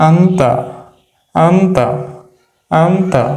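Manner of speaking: আন্তা repeated three times, slow and clear, falling each time; pronunciation demo. Shown as a demonstration. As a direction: drill আন্তা for students to copy. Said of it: "তাহলে উচ্চারণ কি হচ্ছে?".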